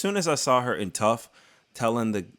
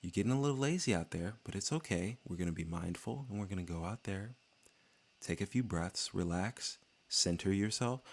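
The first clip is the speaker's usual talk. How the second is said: hushed, softer mock calm coach; calm corner coach; 10 dB quieter than usual. Imitating Tim Welsh calming O'Malley in the corner, hushed and soft. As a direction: softly talk him down between rounds like a zen coach. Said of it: "Tim Welsh is like".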